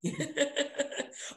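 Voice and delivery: high breathy laugh; real amused laugh. Real, not acted. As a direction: burst out laughing. Laughing at being thought young.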